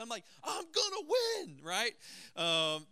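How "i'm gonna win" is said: high, slow, stubborn mock holler; mock defiant yell. Dad holding the door shut against daughter, mock defiant yell. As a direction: draw it out as a hammed-up battle cry. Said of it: "that was my attitude".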